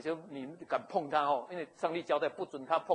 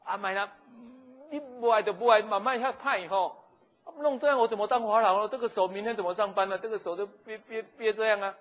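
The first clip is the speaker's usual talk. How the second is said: higher, louder complaining; whining king; 6 semitones higher, 8 dB louder than usual. Acted. Pharaoh whines to Sarai about his hand. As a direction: moan about the twisted hand. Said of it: "就他说".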